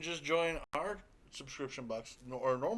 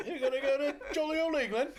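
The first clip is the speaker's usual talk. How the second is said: high, giggly mock-British voice; bad English accent; 11 semitones higher than usual. Teasing about the England trip, hammed-up English accent. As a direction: ham up a jolly English accent. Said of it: "We're terrible at your accent".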